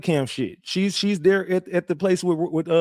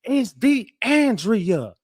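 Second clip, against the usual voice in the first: much higher, drawn out, indignant; retold yell; 9 semitones higher, much slower than usual. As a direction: scream your name at the detective. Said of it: "She yelled it".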